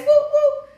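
high wailing siren imitation; bullhorn with siren. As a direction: wail like a police siren.

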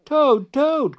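high, loud call by name; loud high storybook cry. A narrator's reading. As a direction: call out high to wake a friend. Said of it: "cried Frog".

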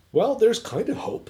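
imitating a hopeful reader; tentative hope. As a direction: lift the pitch, grasping at a hopeful thought. Acting out clinging to hope.

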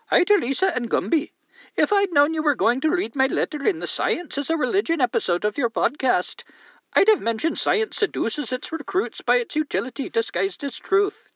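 high, loud put-on Indian accent. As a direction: read the letter in a hammed-up Indian accent, pitched high. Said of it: "try to read it in an Indian accent".

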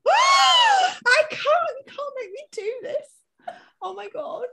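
high, loud wailing protest; mock anguish. Real, not acted. Friend pushing her to choose, cries out in playful anguish. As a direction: cry out as if tortured by the choice. Forced to choose fuck marry kill.

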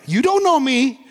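defensive retort. Retold or imitated. Acts out defensive somebody's retort.

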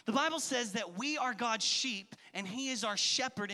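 ordinary talk, nothing marked

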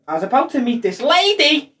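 imitating Pete. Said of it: "as Pete likes to say".

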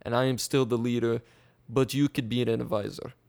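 deliberate put-on Russian accent. Russia bargaining with Latvia over the name, Russian accent. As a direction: insist as a leader in a Russian accent. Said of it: "This is authentic Russian accent".

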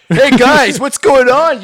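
bright hammed-up puppet hello; Kermit the Frog. Showing his friend his Kermit impression. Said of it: "here's my Kermit the Frog".

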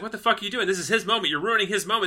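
mock outraged scolding; imitated indignant protest. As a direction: protest on a friend's behalf, words tumbling out. Acts out Cody's angry protest.

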